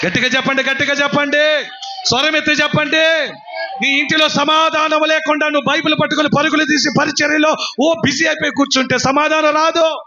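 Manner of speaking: high, driving exhortation; full-force preaching. Acted. Preacher urging the congregation to say it loudly, full-force preaching.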